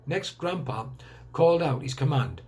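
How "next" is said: usual speaking voice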